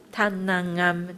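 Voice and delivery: usual speaking voice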